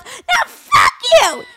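high, slow, loud comeback; imitating mom yelling. Daughter mimics mom swearing back at her, mock screech. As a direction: screech it back, dragging each word. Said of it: "she's trying to yell at me".

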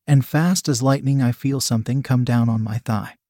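usual speaking voice

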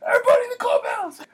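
high, loud, fast panicked yell; urgent shout. Retold or imitated. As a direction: order everyone in at full voice. Coach ordering everyone inside.

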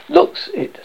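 high-pitched, blunt retort; curt, tactless verdict. A narrator's reading. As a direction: cut in bluntly. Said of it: "said the Arch-Chancellor bluntly".

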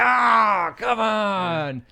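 high, loud groan of protest; frustrated shout. Retold or imitated. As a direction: cry out at the cliffhanger. Outraged at cliffhanger.

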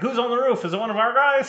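high, loud puzzled cop voice; confused cop. Retold or imitated. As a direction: call up it like a baffled cop. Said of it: "the cop's like".

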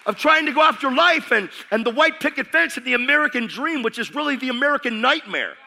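high, loud, driving preacher voice; full-force preaching. Acted. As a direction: preach it hard to the congregation.

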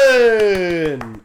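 loud winner's name call; announcer shout. Pretend. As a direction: belt the name like a host.

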